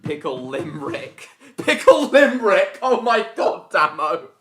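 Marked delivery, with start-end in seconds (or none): 1.6-4.3 high, loud, laughing exclamation; laughing outcry. Real, not acted. Friend cracking up at Pickle Limerick, loud and high. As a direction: burst out with delighted disbelief.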